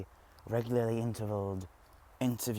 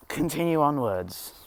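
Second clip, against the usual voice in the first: exasperated; 6 semitones higher, 9 dB louder than usual. Real, not acted. Frustrated loud restart.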